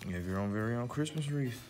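normal talking voice

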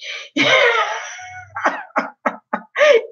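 a long, very high shrieking laugh. Real, not acted. Cracking up over Harry falling into a trap, a long shrieking laugh. Howling at Harry getting trapped.

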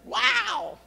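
high, loud, hammed-up wow; mock-astonished wow; amazed wow. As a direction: gasp it out in awe. Reaction to Jesus' promise.

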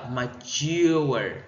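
"mature" as ma-TURE, drawn out on TURE; second-syllable stress. Shown as a demonstration. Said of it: "nó sẽ nhấn âm, âm hai". (nhấn âm hai của "mature")

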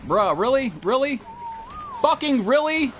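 high-pitched exasperation; exasperated. Real, not acted. Exasperated at enemies in game.

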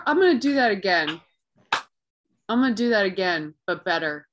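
ordinary talk, nothing marked

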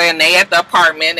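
normal talking voice

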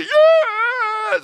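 high drawn-out mock bawl; sobbing little girl. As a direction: bawl it like an inconsolable child. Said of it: "just sobbing all over again".

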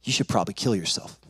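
low, dark inner voice; a low insinuating thought, lightly voiced. Intrusive thought speaks to the angry boy, low inner voice. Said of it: "I hear this inner voice say".

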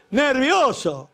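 Nervioso stretched very slow, high and loud; drawn-out mock. Shown as a demonstration. Comic repeating wife's excuse, slow loud mocking. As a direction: drag out Nervioso in disbelief.

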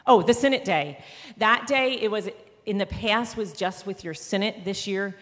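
ordinary talk

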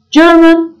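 German pitched high and held out carefully; careful pronunciation model. Shown as a demonstration. Teacher showing learners how German sounds, careful pronunciation. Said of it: "Telaffuza dikkat edelim".

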